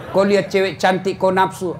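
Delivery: usual speaking voice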